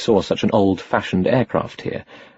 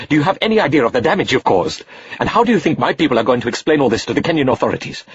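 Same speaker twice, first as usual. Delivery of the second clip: high, raised, furious reproach; angry scolding; 6 semitones higher than usual. Acted. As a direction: scold the reckless boy hotly. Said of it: "Rahim was in no mood".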